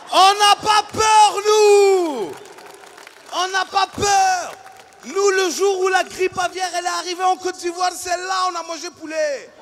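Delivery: comic defiant bellow; defiant shout. Acted. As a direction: shout it proudly to the crowd. Boasting loudly to audience.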